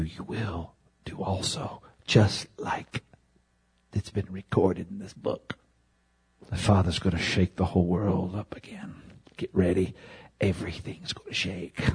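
soft, low urgent whisper; intense hush. Real, not acted. Preacher whispering to congregation of coming shaking, hushed. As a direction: draw them in with a hushed warning.

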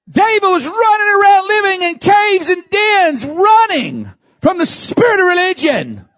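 high, loud, ringing preacher cry; full-force preaching. Acted. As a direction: lift the whole church with it.

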